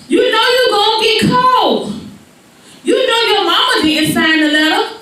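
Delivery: high-pitched, insistent lecturing; acted exasperated father; dad's lecture. Dad lectures child in story.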